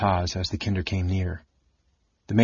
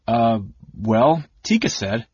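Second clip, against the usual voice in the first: halting, embarrassed start; flustered hesitation; 3 semitones higher than usual. A narrator's reading. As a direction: stall on "Uh, well" before going on. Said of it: "Taz stopped, flushing".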